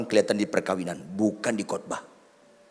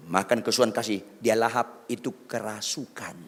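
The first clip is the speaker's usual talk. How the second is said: ordinary talk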